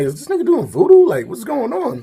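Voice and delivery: high, loud mock disbelief; incredulous. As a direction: exclaim in baffled, hammed-up amazement. Acted disbelief at missed punches.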